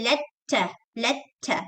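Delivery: "letter" split as "let-ter" with held T; doubled T. Shown as a demonstration. Teacher showing what not to say, doubled T in "letter". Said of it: "You would not stress on it".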